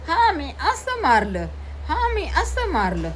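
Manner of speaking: put-on high, slow proud child boast. Son boasts to his mother, high and proud. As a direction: show off like a proud little boy.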